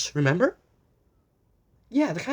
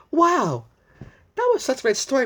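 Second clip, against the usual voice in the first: excited; 9 semitones higher, 6 dB louder than usual. Real, not acted. Reader's enthusiastic reaction.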